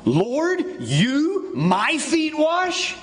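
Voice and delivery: high, slow disbelieving challenge; incredulous Peter. Acted. Pastor voicing Peter's shocked challenge to Jesus, high and drawn out. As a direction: stretch it out, aghast that the Lord would wash him. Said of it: "first three words out of Peter's mouth".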